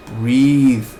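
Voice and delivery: usual speaking voice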